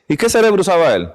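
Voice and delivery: ordinary talk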